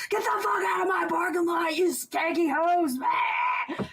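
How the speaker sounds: yelling gruff threats across a lot; shout. Retold or imitated. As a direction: bellow it like an angry bar boss. Boss yelling at street hookers.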